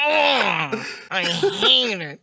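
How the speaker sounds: slow, drawn-out honking groan; hammed-up whiny complaint; mock Metz voice. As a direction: groan it out long in a put-on voice. Continues the Metz impersonation.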